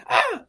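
short, high, loud shriek; imitated startled scream. Protagonist seeing a gremlin flash her, imitated scream. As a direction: yelp as if suddenly horrified. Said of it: "And she screams".

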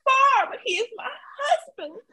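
high, laughing mock Portia voice; a lightly hammed-up Portia, giggling; imitating Portia. Cohost mimics Portia defending her husband, laughing and high. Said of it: "Cordell is not perfect by far".

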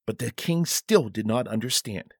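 ordinary delivery, nothing marked

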